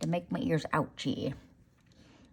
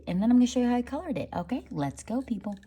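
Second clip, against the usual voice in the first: ordinary talk, nothing marked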